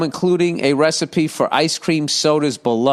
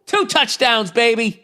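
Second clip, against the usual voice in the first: excited celebration; 8 semitones higher than usual. Real, not acted. Celebrating a touchdown live.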